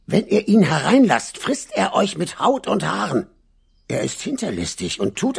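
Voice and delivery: a hint of a concerned mother giving a warning; lightly voiced mother goat. A narrator's reading. Mother goat warns her kids before leaving, lightly voiced concerned mother.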